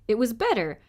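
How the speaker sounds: ordinary delivery, nothing marked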